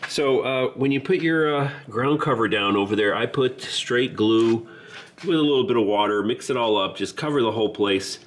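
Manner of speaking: ordinary talk, nothing marked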